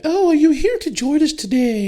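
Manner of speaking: high, falsetto mock voice of the protester. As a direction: squeak it out like a prissy do-gooder. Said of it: "And he went".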